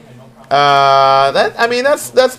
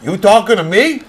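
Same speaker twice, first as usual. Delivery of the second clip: De Niro impression; 5 semitones higher, 3 dB louder than usual. Friends trading movie quotes, De Niro tough-guy impression. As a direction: square up like a tough guy in a mirror. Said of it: "Robert De Niro?".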